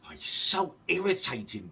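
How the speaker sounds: ordinary delivery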